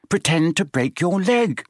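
lightly voiced Hermione. A narrator's reading. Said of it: "Hermione suggested".

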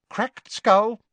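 high, brisk nurse voice; a bustling, no-nonsense matron. Acted. Matron figure in story.

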